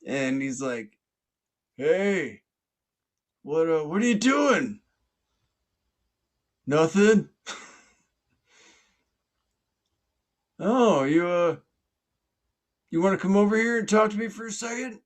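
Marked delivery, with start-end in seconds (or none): 1.8-4.8 acted high, loud call from afar; yelling police officer. Police officer hails a man in a pond, yelling. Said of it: "I hear somebody yelling". 6.7-7.3 high, loud acted reply; yelling back. Man in pond answers police officer, yelling back. 10.6-15.0 high, loud, hurried acted call; yelling police officer. Police officer calls swimmer over, yelling. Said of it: "I hear somebody yelling".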